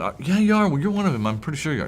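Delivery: high-pitched, mock-insistent bystander; insistent accuser. Bystander accusing Peter in the courtyard, high-pitched and insistent.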